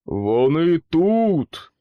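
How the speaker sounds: loud, slow desperate yell; the trapped boot yelling to the searchers; wailing yell. A narrator's reading. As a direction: bellow it to raise the alarm. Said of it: "заволав чобіт".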